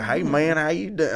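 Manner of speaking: exaggerated drunk Southern accent; drunken Southern accent. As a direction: holler it in a boozy Southern accent. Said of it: "when I get drunk, it's, like, real bad".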